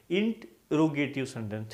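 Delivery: इंटरोगेटिव split as "int, ro-ge-tiv"; corrected pronunciation. Shown as a demonstration. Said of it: "इंट्रोगेटिव sentence नहीं". (इंटरोगेटिव को "इंट, रोगेटिव" अलग करके)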